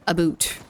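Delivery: "a boot" for "about", Canadian style; mock-Canadian vowel in "a boot"; Canadian pronunciation. Shown as a demonstration. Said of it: "a couple words being different".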